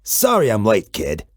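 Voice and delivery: very low, rumbling monster voice; deep monster Gabe. A narrator's reading. Gabe the monster greets the kid, very low and rumbling. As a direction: speak from deep under the bed like a friendly beast.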